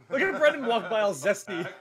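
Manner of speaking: high, loud, laughing call-out; laughing tease. Real, not acted. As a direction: tease him loudly through laughter. Laughing teasing of a passer-by.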